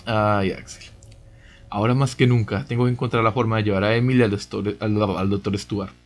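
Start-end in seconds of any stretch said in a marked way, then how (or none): none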